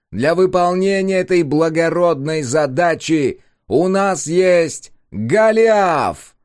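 booming slow public address announcement; loudspeaker voice. A narrator's reading. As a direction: proclaim it through a loudspeaker. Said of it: "продолжал невидимый голос".

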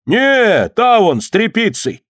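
high, drawn-out correction; acted bystander pointing someone out; calling onlooker. A narrator's reading. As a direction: call it across the crowd.

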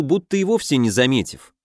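ordinary delivery, nothing marked

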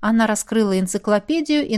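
usual speaking voice

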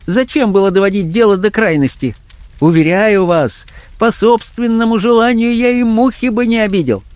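high-pitched, uneasy character voice; nervous fear. A narrator's reading. Blackmailer excusing himself to his victim, afraid. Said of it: "в смехе его послышался страх".

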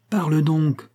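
mildly impatient prodding; rapid insistence. A narrator's reading. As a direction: press him to answer. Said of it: "continua-t-elle rapidement".

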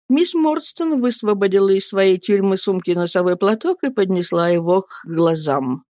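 usual speaking voice

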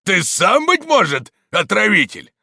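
high, loud suspicious charge; accusing shout. A narrator's reading. Stonecutter accusing the priest, loud suspicious shout. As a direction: throw the accusation in his face.

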